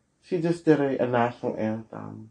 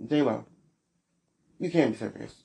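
normal talking voice

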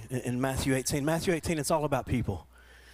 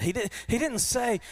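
emphatic preaching; 7 semitones higher than usual. Acted. Preacher mimics lender's demand.

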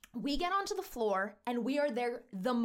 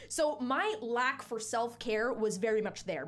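ordinary talk

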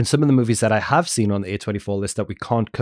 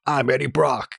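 quick mock take on a bad movie accent; imitating an actor's overdone character voice; Eddie Brock impression; 3 semitones higher than usual. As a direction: do a hammy actor's put-on voice. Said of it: "like Venom, when he does his".

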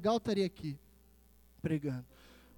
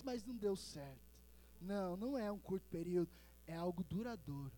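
hushed exhortation; 14 dB quieter than usual. Real, not acted. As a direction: confide gently in the congregation. Drops to hushed, intimate exhortation. (exortação em voz baixa e pausada)

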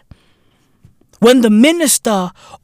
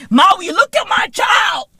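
bragging parent shouting; 9 semitones higher than usual. Retold or imitated. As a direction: show off the kid, hammed up. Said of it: "Motherfuckers wanna brag like".

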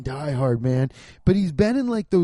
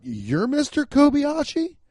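mock disbelief; 10 semitones higher than usual. Disbelief at casting.

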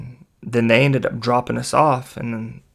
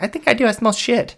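very high-pitched girl imitation; mimicked girl; 11 semitones higher, 4 dB louder than usual. Girl in the car noticing the smell, high voice. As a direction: put on a high, louder girl voice.

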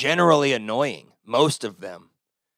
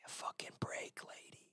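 whispered retort; 26 dB quieter than usual. Retold or imitated.

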